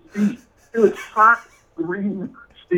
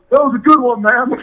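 loud mock praise; 7 semitones higher, 7 dB louder than usual. [name] shouting back after the prank.

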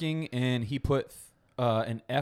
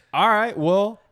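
awkward mom; 8 semitones higher, 10 dB louder, slower than usual. Retold or imitated.

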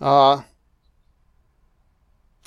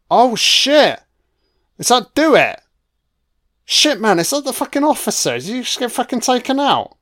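shocked outburst; 9 semitones higher than usual. Real, not acted. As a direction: blurt it out as the twist hits. Shocked by sudden death on screen.

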